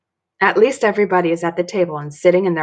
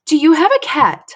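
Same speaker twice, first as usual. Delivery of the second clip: put-on interrupting child; 8 semitones higher, 6 dB louder than usual. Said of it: "I interrupt".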